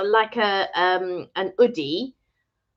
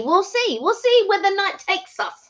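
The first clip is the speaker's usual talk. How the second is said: lilting, teasing sing-song, pitched up; sing-song high; 10 semitones higher than usual. Acted. Repeats phrase in sing-song.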